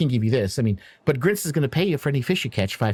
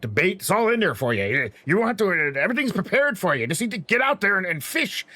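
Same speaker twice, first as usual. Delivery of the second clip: higher-pitched, eager dwarf patter; rambling dwarf fisherman; 9 semitones higher than usual. Acted. Dwarf boss urges the crew out to fish, rambling.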